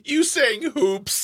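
high-pitched, drawn-out teasing; teasing disbelief. Real, not acted. Co-host ribbing the host over saying hoops, teasing. Co-host teases word choice.

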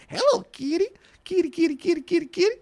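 high singsong calling kitty; sing-song it like calling a cat. Real, not acted. Singsong Hello Kitty, high, laughing.

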